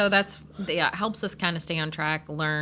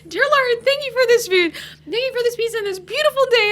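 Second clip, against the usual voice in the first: high, loud praying through giggles; retold prayer; 7 dB louder than usual. Retelling grace over the pizza, giddy prayer. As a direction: recite a mealtime grace while barely holding it together. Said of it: "I'm like praying".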